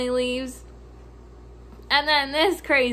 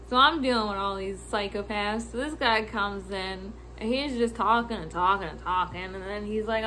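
normal talking voice

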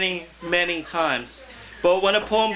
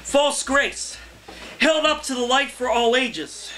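higher, measured open-mic poem delivery; poetry reading; 8 semitones higher than usual. Acted. Poet reading his poem to an open mic crowd, declaiming. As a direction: read it to the room like a performance poem.

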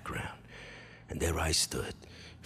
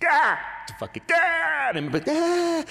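very high, loud screaming in pain; 8 dB louder than usual. Retold or imitated. Boy screams in pain as chlorine hits, very high and loud.

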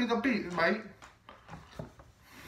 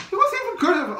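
indignant outburst; 8 semitones higher, 7 dB louder, faster than usual. Real, not acted. Arguing about the game.

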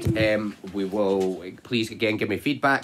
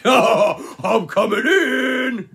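slow, loud, higher drawn-out wolf cry; big bad wolf; 13 semitones higher, 10 dB louder, much slower than usual. Wolf announcing he is coming in, slow and loud. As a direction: stretch it out like a gleeful villain.

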